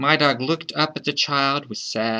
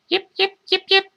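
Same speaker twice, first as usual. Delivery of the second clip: very high, squeaky little yips; short, high-pitched small-dog yapping; tiny dog. Acted. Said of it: "Tiny Dog said".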